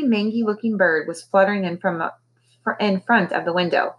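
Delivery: plain reading voice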